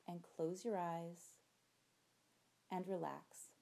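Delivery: calm, unhurried meditation voice; guided meditation. Acted. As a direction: ease them into relaxation. Leads a relaxation meditation.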